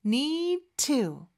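"need to" slowed, D unreleased into a clear T; teacher's drawn-out "need to" linking; D held into T. Shown as a demonstration. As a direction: stop on the d in "need", release only the t of "to". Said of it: "이렇게 발음하는 겁니다".

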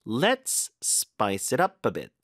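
slow, weight on LET'S and UP; slow drill, "Let's" set apart and stressed. Shown as a demonstration. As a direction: model it deliberately, leaning into "Let's". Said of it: "let's에 힘 좀 주세요".

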